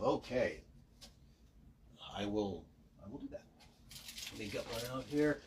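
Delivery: ordinary talk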